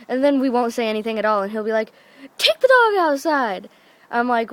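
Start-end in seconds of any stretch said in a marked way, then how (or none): 2.4-3.7 high, loud mock yell; imitating dad hollering an order; irritated order. Half-asleep dad orders the dog out, irritated.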